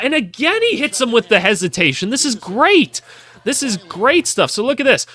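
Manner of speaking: high-pitched thrilled play reaction; excited. Real, not acted. Reactor thrilled by a hesitation move, excited.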